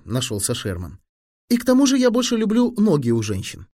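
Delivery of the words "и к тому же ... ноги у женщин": put-on high boyish self-justifying; boy making excuses. A narrator's reading. Sherman excusing himself to Fili, high-pitched.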